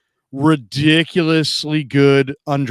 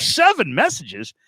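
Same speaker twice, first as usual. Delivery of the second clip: higher, drawn-out disbelief on the number; incredulous reaction; 5 semitones higher, slower than usual. Retold or imitated. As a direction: react astonished, stretching the words. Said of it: "I'm like".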